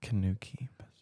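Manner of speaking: soft, voiceless whisper. Real, not acted. Player trying out a puzzle phrase, whispering. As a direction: whisper it as if to yourself. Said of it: "you're not saying it loud".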